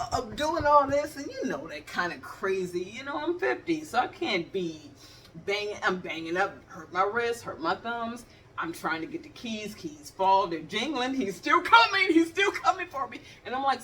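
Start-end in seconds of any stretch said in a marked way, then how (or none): none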